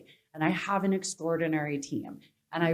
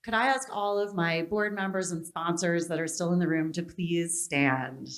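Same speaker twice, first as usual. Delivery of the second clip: normal talking voice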